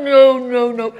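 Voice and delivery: very loud, drawn out no no no; loud drunk refusal. Retold or imitated. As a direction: hold on and refuse loudly, hammed up. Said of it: "And she says".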